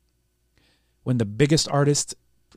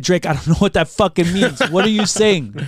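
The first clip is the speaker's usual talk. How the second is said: baffled; 6 semitones higher, 6 dB louder than usual. Retold or imitated. Retelling confused reply to Drake.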